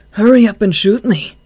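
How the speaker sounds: loud, high defiant dare; taunting. Acted. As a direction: taunt him to shoot. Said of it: "Chuya taunted".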